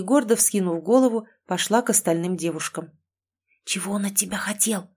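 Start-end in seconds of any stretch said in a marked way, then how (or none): 3.6-4.9 hushed conspiratorial whisper; whispering a secret. Acted. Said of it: "заговорщически зашептала Кэти".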